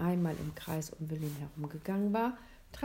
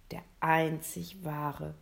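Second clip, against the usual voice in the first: plain reading voice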